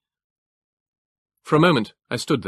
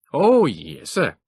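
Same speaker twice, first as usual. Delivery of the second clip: higher, louder clipped northeast English trooper; northeast England accent; 6 semitones higher, 5 dB louder, much slower than usual. A narrator's reading. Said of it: "the clipped, Teutonic accent of the northeast of England".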